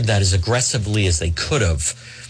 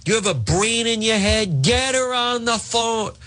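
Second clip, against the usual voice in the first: high mock cranky yell; hammed-up old boss scolding; berating Eva in mock fury; mock angry yell; 9 semitones higher than usual. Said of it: "yelling at Eva".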